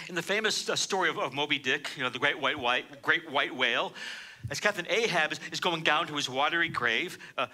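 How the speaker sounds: ordinary talk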